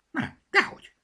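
very high, small hushed creature voice; tiny bunny's stage whisper; whispering little rabbit. A narrator's reading. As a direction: squeak out a secretive denial. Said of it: "suttogta Zorka".

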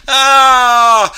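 long, high, loud "aah"; mock falling scream. Chimp shows mouse the sinking stair, yelling. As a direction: yell as the step drops away.

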